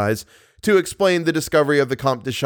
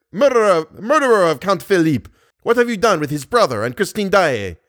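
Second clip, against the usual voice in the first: loud, high, furious accusing cry; angry accusation; 6 semitones higher, 3 dB louder than usual. Acted. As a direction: hurl the charge at the murderer. Persian confronts the ghost Eric.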